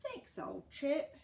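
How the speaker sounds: lightly voiced thanks from a kindly neighbour; old Fred, grateful.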